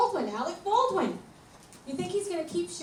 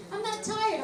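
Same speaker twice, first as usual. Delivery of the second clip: mock whiny child's voice, pitched up; whiny kid; 7 semitones higher than usual. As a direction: whine it like a kid avoiding bed. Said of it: "You know how the kids say that".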